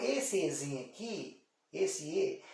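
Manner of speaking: normal talking voice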